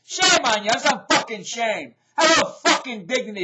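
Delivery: loud, high-pitched telling-off; angry scolding. Real, not acted. Vlogger scolding a singer for her act, angry and loud. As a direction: scold her with outraged force.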